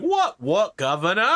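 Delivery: a light mock British guard; lightly hammed-up British guard, huffing. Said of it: "And other extremely British things".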